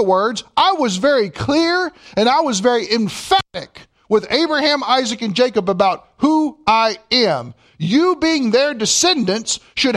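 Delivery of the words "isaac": ordinary delivery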